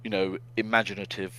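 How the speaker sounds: ordinary talk, nothing marked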